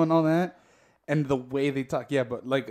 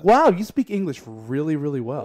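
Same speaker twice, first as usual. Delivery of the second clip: mock amazed compliment; 8 dB louder than usual. Woman imitating people who praise her English, mock-amazed. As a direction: gush as a patronizing admirer, starting loud. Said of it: "They're like".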